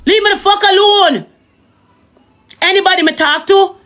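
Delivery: high pitched angry rant. Real, not acted. Ranting at the ex.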